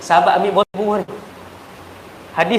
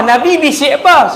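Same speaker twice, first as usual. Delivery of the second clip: louder, higher, pressing the question; annoyed demand; 7 semitones higher, 5 dB louder than usual. Retold or imitated. Aisyah pressing Fatimah for the secret, mildly annoyed. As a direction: push the question harder, getting cross. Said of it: "dia lagi geram".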